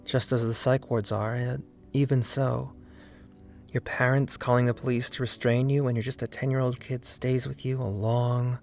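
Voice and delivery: ordinary delivery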